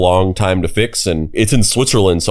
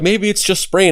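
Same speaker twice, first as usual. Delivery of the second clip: imitated hopefulness in a raised pitch; hopeful; 11 semitones higher than usual. Bystanders guessing it's only a sprain, mock hopeful. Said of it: "they're kinda being hopeful".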